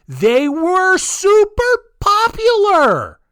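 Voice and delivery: high, loud, drawn-out disbelief; flabbergasted outburst. Real, not acted. Host on cult jackets selling, flabbergasted. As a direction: stretch each word in rising amazement. Mind-blown at the jackets' success.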